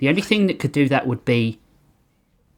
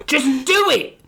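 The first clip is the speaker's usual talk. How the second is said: high shout; 13 semitones higher, slower than usual. Acted. Lee snapping at Hank.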